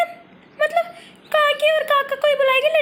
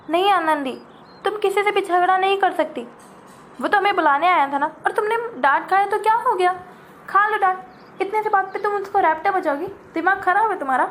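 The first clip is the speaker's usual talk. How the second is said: put-on much lower, firm older voice; scolding elder; 7 semitones lower than usual. Elder sister telling Anandi off for wanting to slap, low and firm. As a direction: scold her like a big sister.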